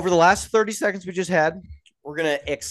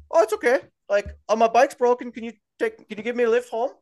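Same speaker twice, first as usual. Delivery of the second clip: Conor being easygoing; 7 semitones higher than usual. Retold or imitated. Conor answering the driver kindly.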